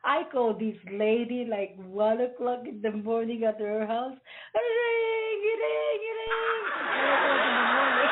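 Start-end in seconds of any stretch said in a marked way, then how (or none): 4.5-6.0 high sing-song ring ring; phone ring imitation. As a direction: chirp it like a phone. Imitating phone ringing at night.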